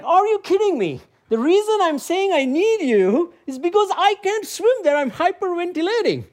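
high, panicked disbelief, gasping for help; desperate plea. Retold or imitated. Swimmer begging the kayak volunteer, high and panicked. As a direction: beg the kayaker, half in panic.

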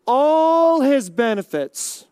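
"All" stretched very long, then falling away; slow, weighty "All" leading the phrase; stress on "All". Shown as a demonstration. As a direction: hold the word "All" for emphasis. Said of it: "I wanna emphasize the word all".

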